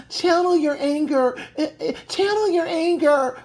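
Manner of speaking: high, mock-tearful imitation of a prim boss; mocking a highly educated boss. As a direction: parody her lofty tone with fake whimpering. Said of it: "She speaks like a highly educated boss".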